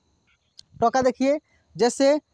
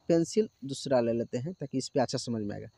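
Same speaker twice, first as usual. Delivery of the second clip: much lower and softer, quick; muttered aside; 12 semitones lower, 10 dB quieter, faster than usual. Real, not acted. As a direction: say it under your breath while switching pencils. Aside while changing pencil. (Pencil बदलते हुए धीमे, तेज़ी से बुदबुदाना)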